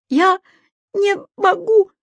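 high, loud hysterical whine; frightened girl answering the old man hysterically; hysterical squeal. A narrator's reading. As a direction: squeal it out on the edge of tears. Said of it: "с истерическим подвизгиванием".

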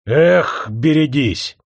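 worker's angry exclamation; threatening cry. A narrator's reading. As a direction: call out a fierce warning.

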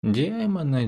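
high drawn-out first демоны; lilting languid демоны; sultry drawl. Acted. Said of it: "томно протянула".

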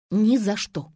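loud, emphatic no; flat refusal. A narrator's reading. Nadya refuses to look after hens, cutting him off. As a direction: cut the request off firmly. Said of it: "отрезала девушка".

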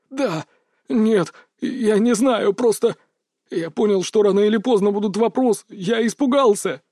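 high, panicky and halting; frightened babbling. A narrator's reading. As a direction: stumble over words in panic. Terrified man babbling excuses.

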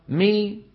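مي released into a long ee; meem with kasra. Shown as a demonstration. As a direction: part the lips from m into a held ee on مي. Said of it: "انطق ميم مكسورة". (مي بميم مكسورة ممدودة)

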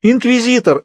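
high tearful wail, a touch of sobbing; crying out. A narrator's reading. Hairdresser tearfully tells the surgeon who threatened her, high tearful cry. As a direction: cry the word out through tears. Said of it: "с плачем протянула".

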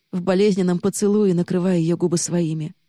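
plain reading voice

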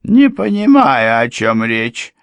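high, loud, slow stubborn denial. A narrator's reading. Housekeeper stubbornly denying everything, high and slow. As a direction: dig in and refuse to understand. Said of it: "упрямо стояла на своём экономка".

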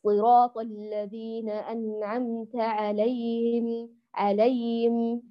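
slow recitation with "عليهم" as "ʕalayim", h lost; drawn-out "عليهم" with no audible h; vanishing haa. Shown as a demonstration. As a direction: let the h of "عليهم" fade away. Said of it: "تلاشي صوت الهاء وإضعافها".

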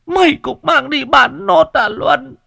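high, loud sobbing lament; half crying. Acted. Old mother laments son sold everything, high and loud, crying. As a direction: plead through tears. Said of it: "nói như khóc".

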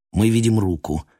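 ordinary delivery, nothing marked

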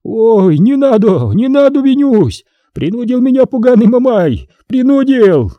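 high, loud desperate pleading; old man wailing as the executioner approaches; terrified wailing. A narrator's reading. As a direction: beg for mercy before the whip. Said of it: "завопил".

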